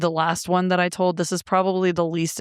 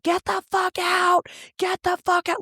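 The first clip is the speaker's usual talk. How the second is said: furious yelling; 13 semitones higher than usual. Retold or imitated. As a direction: yell it high, like you've lost it. Retold losing it at ex.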